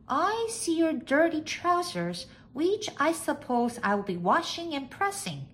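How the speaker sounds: high-pitched maid's voice; high, matter-of-course maid; dutiful maid. A narrator's reading. Maid answers master.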